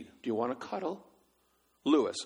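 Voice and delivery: usual speaking voice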